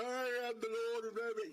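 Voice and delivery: high, fast, puffed-up lordly voice; mock a pompous knight's boast. Dragon mocking knights, high fast pompous voice. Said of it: "You know".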